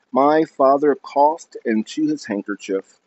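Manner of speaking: plain reading voice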